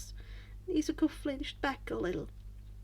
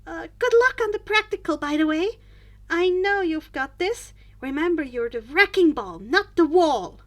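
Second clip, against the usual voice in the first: loud, upbeat and bright-hearted; encouraging pep talk; 5 semitones higher, 10 dB louder than usual. A narrator's reading. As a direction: cheer him on before the test. Encouraging friend before exam.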